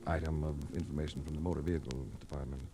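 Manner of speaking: low, even first-person narration; detective narration. A narrator's reading. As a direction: recount the case like a hard-boiled detective. Narrator recounts the case.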